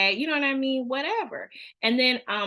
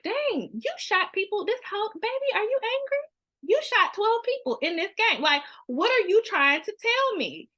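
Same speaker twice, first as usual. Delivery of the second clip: much higher, playfully shocked voice; mock alarmed parent; 11 semitones higher than usual. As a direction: act out a stunned mom asking what's wrong. Parent reacting to violent video game.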